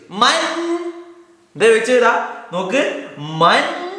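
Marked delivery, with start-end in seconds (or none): 0.1-0.8 high questioning goat voice; goat asking. Acted. Teacher voicing the goat asking the lion who he is, high and puzzled. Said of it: "ചോദ്യം അങ്ങ് ചോദിച്ചു". 3.2-3.9 goat asking. Acted. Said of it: "ഒരു ചോദ്യം അങ്ങ് ചോദിച്ചു".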